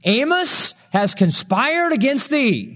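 high, slow, ringing accusation; preacher proclaiming the accuser's charge; accuser's declaration. Acted. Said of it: "this is what he said".

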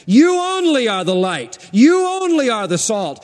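"you" stressed, both lines falling. Shown as a demonstration. Preacher stressing "you" to the congregation. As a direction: lean on the word "you". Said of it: "the pronouns are emphatic".

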